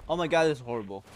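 loud, exasperated complaint; loud dismay. Real, not acted. Dismayed at fight going badly.

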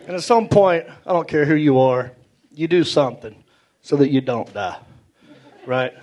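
ordinary talk, nothing marked

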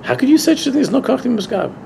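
high-pitched, appalled objection; incredulous protest. Retold or imitated. Challenging a man who says youngsters lack strength, incredulous. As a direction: retell a protest in rising disbelief.

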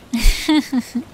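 put-on a light, soft girlish giggle. Treeflower giggles softly while cuddling her boyfriend. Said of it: "Treeflower smiles before giggling softly".

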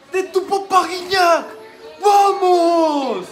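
very high, loud joyful shouting; ecstatic cheering. Real, not acted. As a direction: cheer with laughing joy. Fan celebrating the goal.

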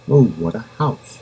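ordinary delivery, nothing marked

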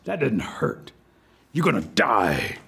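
low, slowing threat from an alligator; menacing alligator. Acted. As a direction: drop low and draw out the threat.

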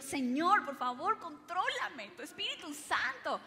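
prayerful pleading. Retold or imitated. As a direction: beg God for control. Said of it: "Y yo".

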